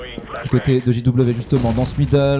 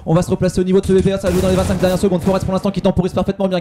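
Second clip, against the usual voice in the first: fast play-by-play; 3 semitones higher, faster than usual. Real, not acted. Caster speeds up late round.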